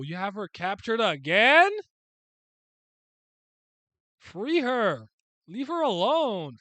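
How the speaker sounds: high, loud drawn-out protest; indignant outburst. Acted. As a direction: yell it out, outraged on her behalf. Indignant outburst at story's captor.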